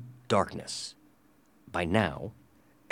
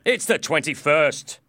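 loud, pitched-up impatient answer; impatient reply; 7 semitones higher, 6 dB louder than usual. A narrator's reading. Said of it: "he replied impatiently".

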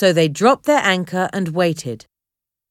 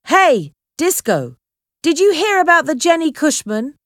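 calling out; 8 semitones higher than usual. A narrator's reading. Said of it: "called a voice from the boat".

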